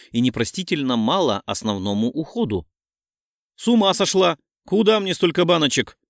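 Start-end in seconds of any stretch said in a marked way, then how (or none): 3.6-6.0 higher-pitched, indignant protest. A narrator's reading. Friend Lera objects to so many skincare jars, indignant. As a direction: protest in outraged disbelief. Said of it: "возмутилась Лерка".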